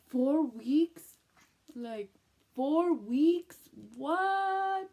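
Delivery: high, loud incredulous squeal; can't believe it's already four weeks; shocked disbelief. Retold or imitated.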